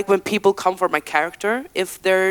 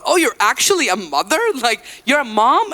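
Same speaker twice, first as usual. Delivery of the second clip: mock surprise, pitched higher; surprised questioning; 7 semitones higher than usual. People asking the singer about being a mom, surprised. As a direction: imitate people's incredulous questions.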